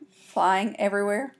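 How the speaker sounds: ordinary delivery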